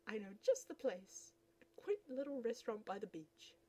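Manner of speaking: ordinary talk, nothing marked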